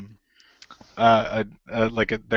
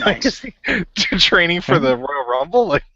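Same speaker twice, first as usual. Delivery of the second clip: high-pitched words broken up by laughter; real laughter bubbling through the question; laughing incredulously; 8 semitones higher, 4 dB louder than usual. Real, not acted. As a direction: crack up while asking in disbelief. Cracking up at the wrestling move.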